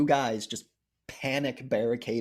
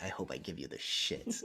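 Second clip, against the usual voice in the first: low defiant line; 8 semitones lower, 11 dB quieter than usual. Retold or imitated. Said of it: "it's even better to be like".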